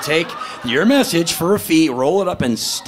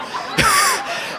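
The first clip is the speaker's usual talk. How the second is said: real laugh. Real, not acted. Comic laughing at own joke.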